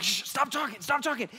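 high, hushed mock panic; urgent hushing. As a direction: shush a child urgently under your breath. Dad hushing son in retold panic.

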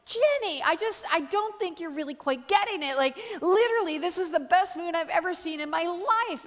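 high, exasperated pleading with a friend; mock outrage. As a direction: insist emphatically, as if nobody gets it. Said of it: "I'm outraged".

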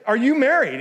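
host presses the guest a second time; blunt question. Retold or imitated. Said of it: "So I said".

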